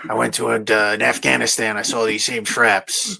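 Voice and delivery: lightly imitating a gruff soldier; a hint of a tough military guy. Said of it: "why are you talking like that".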